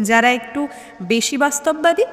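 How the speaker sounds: plain reading voice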